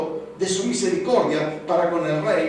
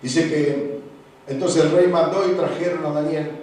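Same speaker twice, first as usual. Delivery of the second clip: normal talking voice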